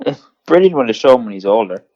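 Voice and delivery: ordinary talk, nothing marked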